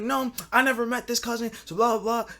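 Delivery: lightly mimicked high-pitched protest; mad girlfriend. Girlfriend objecting about an unknown cousin, mildly mock mad. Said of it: "she got mad over it".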